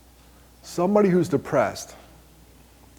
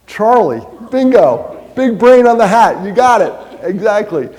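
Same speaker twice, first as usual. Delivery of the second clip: loud and high, hyping the winner; excited game-show shout; 6 semitones higher, 8 dB louder than usual. Real, not acted. Host rewarding a correct audience answer with a loud cheer.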